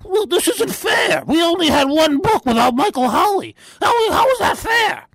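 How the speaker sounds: mocking Keefe's complaint. Mocking a rival host's complaint in a high whine. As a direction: whine it up high like a sore loser. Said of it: "Keefe went on".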